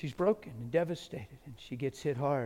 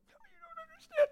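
much higher, fast desperate insistence; pleading woman; faster than usual. Retold or imitated. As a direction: imitate her rushed, high plea. Woman pleading in his ear.

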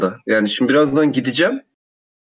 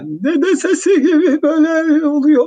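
high wobbly grandpa voice with flanger; 12 semitones higher than usual. Real, not acted. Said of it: "Ben kendi sesimde flanger deniyorum".

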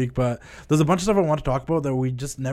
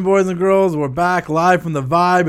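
big show-opening announcer call; 5 semitones higher, 5 dB louder, slower than usual. Acted. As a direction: open the show like a ring announcer. Show opener announcer intro.

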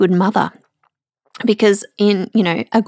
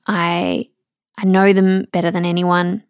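ordinary talk, nothing marked

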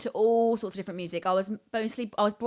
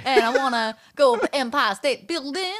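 louder, hammed-up American accent; exaggerated Southern-tinged American accent; 4 semitones higher, 6 dB louder than usual. Shown as a demonstration. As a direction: play the loud American tourist accent. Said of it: "in an American accent".

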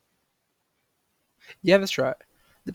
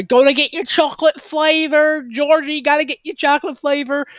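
higher, sing-song mock of a protein fan; mocking gym bro; 5 semitones higher than usual. Mocking people who buy chocolate protein powder, a light sing-song mock. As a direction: parody a fitness buyer lightly.

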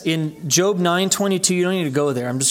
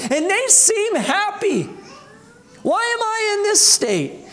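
voicing a troubled believer's inner doubt, pitched higher. Preacher voicing a doubting believer to the brethren, higher and troubled. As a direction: ask it like a restless soul envying others. Believer envying the happy sinners.

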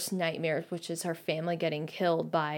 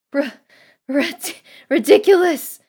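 stammered, loud, high desperate shout; acted sobbing shout, breaking into stutters; sobbing cry; 9 semitones higher, 12 dB louder than usual. A narrator's reading. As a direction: stammer it out, then cry it loudly. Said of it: "Missus Weasley sobbed".

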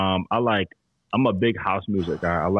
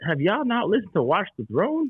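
incredulous; 11 semitones higher than usual. Retold or imitated. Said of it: "I'm like".

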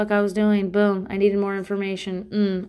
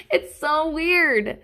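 mock amazement; 7 semitones higher, much slower than usual. Same phrase, drawn out.

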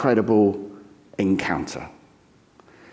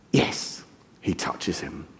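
ordinary delivery

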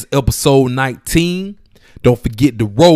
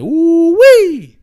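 much higher, louder excited whoop; hyped whoop; 12 semitones higher, 6 dB louder than usual. Real, not acted. Host hyped about the road show podcast, whooping. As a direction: let the hype burst out in a cry. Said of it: "Man, I'm so hyped for this thing".

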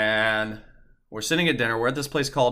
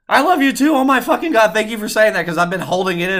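much higher, louder gushing relief; squealing back I love you too; overjoyed reply; 10 semitones higher, 8 dB louder than usual. Retold or imitated. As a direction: burst out with giddy joy.

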